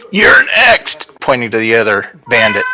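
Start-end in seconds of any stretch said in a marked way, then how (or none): 0.1-1.1 high, loud halfling yell; little halfling voice. Acted. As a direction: shout a threat in a small halfling voice. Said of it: "yell out in my little halfling voice".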